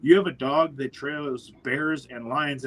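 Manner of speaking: ordinary talk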